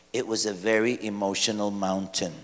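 soft, low dry sarcasm; sarcastic. Acted. Preacher mocking critics to the congregation, dry sarcasm.

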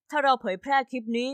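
ordinary delivery, nothing marked

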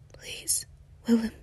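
begging whisper. Acted. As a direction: whisper his name as a plea. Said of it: "he whispered".

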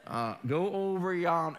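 dragged-out mock Southern accent; slow Southern drawl. Shown as a demonstration. Preacher showing Southerners' slow talk, drawn-out Southern accent. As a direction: stretch it out real slow, Southern style. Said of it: "they talk real slow like this".

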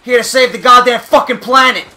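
loud sarcastic rant; angry shout. Real, not acted. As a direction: bellow it out, fed up. Raging at side quest.

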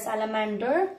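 American "salamander" ending in a sounded R; American accent. Shown as a demonstration. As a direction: give "salamander" the American R-coloured "der" ending. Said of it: "so this you can be".

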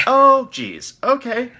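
high, loud dismayed "Oh, jeez"; shocked. Acted. Aide reacting to an absurd demand, shocked. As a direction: recoil in disbelief.

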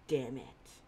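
ordinary delivery, nothing marked

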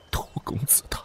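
fake-grieving prince whispers, voice failing; breathy broken whisper. Prince faking grief to his guard, whispered. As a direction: let the name die into a whisper. Feigned grief, voice breaking to nothing.

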